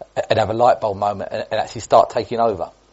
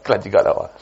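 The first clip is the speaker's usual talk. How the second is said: talking through a laugh; amused aside; 3 semitones lower than usual. Real, not acted. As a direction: still chuckling at the mishap. Laughing reaction to mishap.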